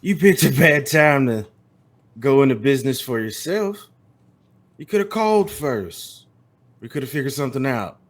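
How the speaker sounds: loud, threatening cowboy. Acted. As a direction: lean in on him like a gunslinger. GM voices Tex, menacing.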